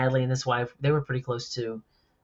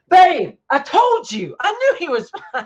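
much higher, louder and slow, drawn-out told-you-so; smug gloating; 10 dB louder than usual. Retold or imitated. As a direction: lord it over your wife, laughing at the end. Gloating to wife after call.

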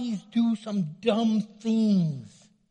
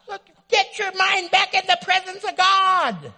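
much higher, louder call to attention; loud rebuke; 11 semitones higher, 7 dB louder than usual. Real, not acted. Preacher calling the distracted back, higher, louder rebuke. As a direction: snap them back to God.

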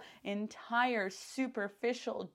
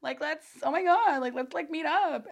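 excited gushing; 7 semitones higher, 5 dB louder than usual. Retold or imitated. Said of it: "I'm like".

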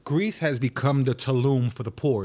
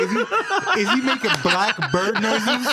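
laughing; 7 semitones higher than usual. Retold or imitated. Laughing retelling, high.

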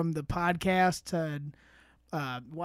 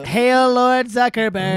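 slow, loud ceremonial chant; mock reverent hail; 4 semitones higher, 7 dB louder, slower than usual. Host jokes about metaverse podcast, mock worshipful hail. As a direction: proclaim it like a cult follower, drawn out.

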